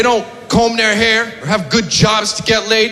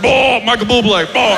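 gruff, grunting bear picking a song. Retold or imitated. Male bear picking romantic music, gruff grunt. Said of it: "He was like".

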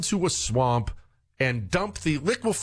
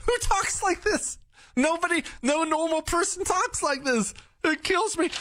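high, squeaky voice through laughter; cracking up. Real, not acted. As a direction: squeal it out, helpless with amusement. Host cracking up at played clip.

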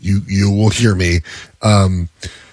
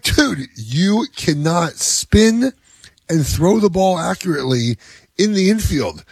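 higher, very slow scolding explanation; lecturing dad; 8 semitones higher, slower than usual. Retold or imitated. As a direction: spell it out slowly to the kid. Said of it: "I'm like".

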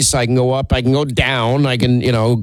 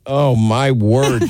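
laughing exasperation; 3 semitones higher, much slower than usual. Real, not acted. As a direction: give up and crack up. Host laughing at co-host jab.

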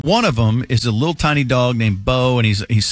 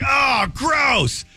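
mocking his wife, way up high; wife's disgusted shriek; 12 semitones higher than usual. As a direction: shriek it like she's grossed out. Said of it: "she's like".

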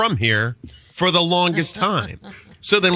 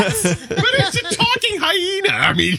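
laughing retort; 13 semitones higher than usual. Real, not acted. Cohost laughing back at the Lion King complaint, high laughing protest. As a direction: laugh it out, it's just a hyena.

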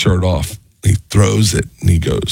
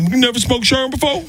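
much higher, rising, mocking Tracy voice; Tracy Morgan impression; 3 dB quieter than usual. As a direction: push it way up like Tracy pushing the joke. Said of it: "And he said".

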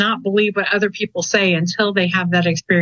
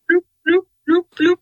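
high-pitched "bloop" bubble noises; playful high bubbly blooping; imitated goldfish bubbling; 10 semitones higher, faster than usual. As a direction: mimic a haunting goldfish with high little bloops. Imitates ghost goldfish keeping woman awake.

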